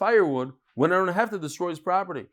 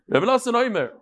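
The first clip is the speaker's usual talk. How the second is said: usual speaking voice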